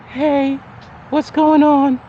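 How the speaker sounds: lightly mimicking her sorry; apologetic woman. Voicing her text after a week.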